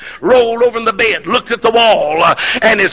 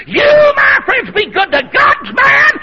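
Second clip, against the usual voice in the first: full-force preaching; 4 dB louder, slower than usual. Real, not acted. Preacher urging friends to honor God's man, full-force preaching. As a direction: cry out the exhortation with stretched words.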